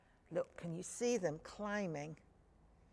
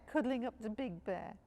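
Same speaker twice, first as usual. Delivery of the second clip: ordinary delivery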